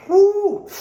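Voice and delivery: high loud whoop from the cold; cold shock whoop. Real, not acted. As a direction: yelp at the icy water.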